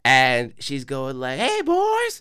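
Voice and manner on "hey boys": very high, strange creaky call of a hobbling stranger; crackhead voice. Retold or imitated. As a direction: squeak it out like an odd street lady. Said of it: "she had this crackhead voice".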